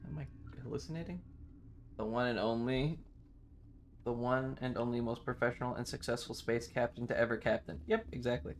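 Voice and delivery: usual speaking voice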